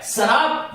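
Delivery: "श्राप" loosened into two syllables, saraap; common derived form. Shown as a demonstration. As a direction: say "श्राप" with plain s and a vowel before r. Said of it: "ये शब्द तो चलन में है".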